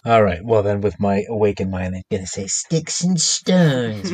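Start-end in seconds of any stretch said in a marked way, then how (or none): 2.5-4.1 drawn-out, higher sing-song taunt; playful mock incantation. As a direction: tease slowly, stretching each word. Mock psychic taunt at nurse.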